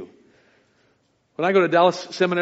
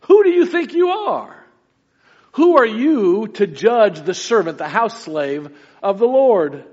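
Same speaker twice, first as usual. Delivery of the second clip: "Who" flung out much higher and louder; emphatic who; 4 semitones higher, 4 dB louder than usual. Real, not acted. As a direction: hammer "Who do you think you are" at them. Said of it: "That phrase is emphatic".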